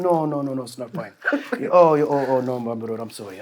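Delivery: soft, hushed pleading African vendor; imitating sorry vendor. Vendor insisting to [name] it's not fine, hushed pleading. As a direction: murmur the apology, ashamed.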